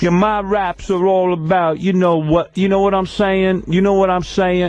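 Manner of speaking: imitating [name]'s slang. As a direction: drag out the street slang in parody. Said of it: "is that how he talks?".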